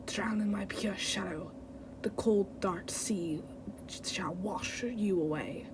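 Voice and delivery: low, soft, whispery menace; slow down into a sinister near-whisper; menacing villain. Acted. Villain promising a watery grave, low whispery menace. Redoes villain line after accent slipped.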